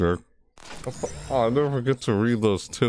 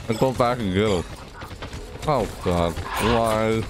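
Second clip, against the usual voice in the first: ordinary talk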